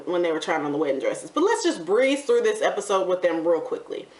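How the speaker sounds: normal talking voice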